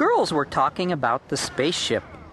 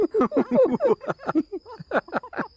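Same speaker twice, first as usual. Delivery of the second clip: high-pitched peals of laughter; everyone laughing. A narrator's reading. Boys laughing after the pizza surprise, relieved laughter. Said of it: "Everyone laughed".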